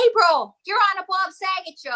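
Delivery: excited. Retold or imitated. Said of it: "everyone's like".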